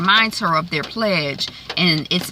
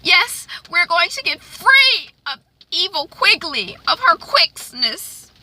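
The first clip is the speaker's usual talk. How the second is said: high-pitched, hammed-up jubilant hope; hopeful happiness; 11 semitones higher, 3 dB louder than usual. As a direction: act overjoyed at the thought of escape. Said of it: "potential happiness".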